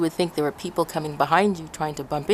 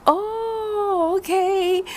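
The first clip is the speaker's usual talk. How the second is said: realizing; 13 semitones higher, much slower than usual. Retold or imitated. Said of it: "I'm like".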